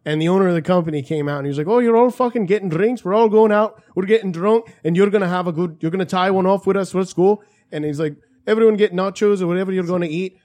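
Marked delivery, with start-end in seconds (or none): 1.7-10.4 put-on Irish accent. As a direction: do a rowdy Irish boss. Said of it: "Conor McGregor?".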